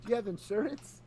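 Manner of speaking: high whiny dude voice; prank recording voice. Retold or imitated. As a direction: whine it like a stoner accusing. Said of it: "And he goes".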